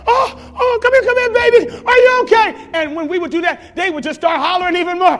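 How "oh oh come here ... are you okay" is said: put-on high, loud frantic calling to a hurt baby; panicked parent. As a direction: rush in shouting in panic. Panicked parent rushing to child.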